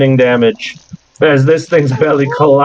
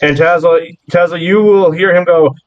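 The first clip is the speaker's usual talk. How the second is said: normal talking voice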